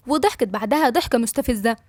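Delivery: usual speaking voice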